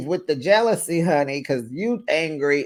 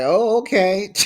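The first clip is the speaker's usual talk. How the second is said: sarcastic; 7 semitones higher, 5 dB louder than usual. Retold or imitated. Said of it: "I'm like".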